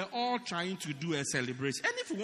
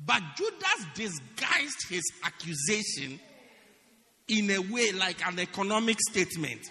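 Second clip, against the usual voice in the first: usual speaking voice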